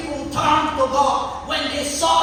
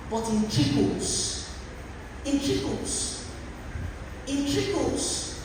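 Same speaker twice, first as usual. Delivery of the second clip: drawn out softly; 6 dB quieter, slower than usual. Acted. Preacher lingering softly on trickles. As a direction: let the word trickle out quietly.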